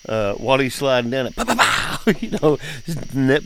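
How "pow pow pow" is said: high mock pow pow pow of a nail gun; playful nail gun noises; nail gun sounds. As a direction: pop out the gun shots up high. Imitating nail gun shots.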